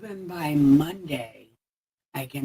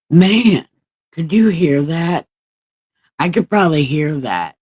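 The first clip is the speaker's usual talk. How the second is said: testing louder; 2 semitones higher, 9 dB louder than usual. Shown as a demonstration. Streamer testing his volume for the chat, loud. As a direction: ask it out loud and bright.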